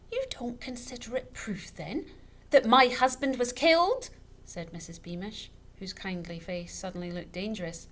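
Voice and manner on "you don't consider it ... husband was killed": offended widow. A narrator's reading. Offended widow answers back.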